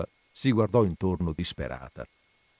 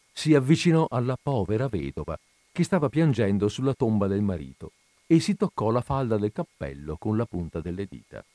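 ordinary delivery

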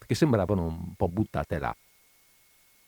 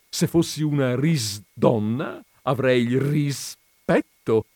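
higher, slow pun delivery; jovial explanation; 4 semitones higher, 3 dB louder, slower than usual. A narrator's reading. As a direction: spell out the wordplay cheerfully. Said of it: "spiegò giovialmente". (spiegazione gioviale, scandita)

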